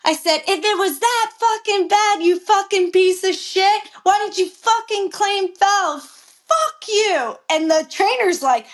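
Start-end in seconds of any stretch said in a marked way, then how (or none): 0.5-7.4 high-pitched, indignant tirade; retold cursing out. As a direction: lay into him, replaying the outburst. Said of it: "curse somebody out like that".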